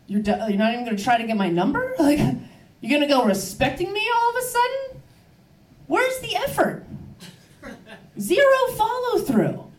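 high, incredulous complaint; mock indignation. Comic to the catcaller who gave up, mock indignant. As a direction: climb high in affronted disbelief.

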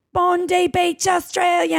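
high, drawn-out Aussie announcer; Australian voice. Retold or imitated. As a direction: announce it grandly in a mock Australian accent. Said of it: "that's my Australian voice".

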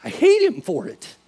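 high, loud anguished protest; retold tearful outburst. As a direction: act out hurt swelling on the high note. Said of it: "And I'm just crying".

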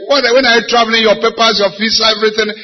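high, loud and demanding; angry demand. Retold or imitated. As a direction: press him hard like an impatient elder brother. Brother demanding on the phone.

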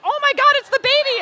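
high, loud echoed exclamation; shocked husband. Retold or imitated. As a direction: echo the shriek as the stunned husband.

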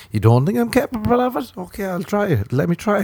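high, softening mock-wounded voice; hurt protest. Partner handed a fork for porridge, high hurt protest. Acting hurt, about to cry.

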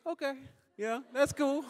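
very high mimicked toddler voice; little daughter. Two-year-old accepting Dad's blindness, high-pitched imitation. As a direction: chirp acceptance like a small kid. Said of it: "And she's like".

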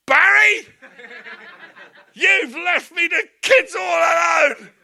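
slow, high, loud shouting; mock shouted complaint. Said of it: "somebody burst in here and say".

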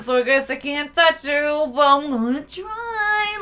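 half-hearted mock Frenchie; mock flirt. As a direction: lay on the creepy charm, slow.

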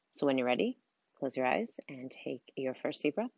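soft, unhurried meditation voice with long pauses; guided meditation. Acted. Coach leads listeners through breathing and visualizing their dream life, guided meditation. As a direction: guide them gently through breathing and imagining, softly.

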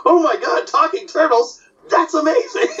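very high cartoon voice with a laugh; amazed crow. Crow meeting talking turtles, amazed. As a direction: squawk in high-pitched mock wonder. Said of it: "the crow's like".

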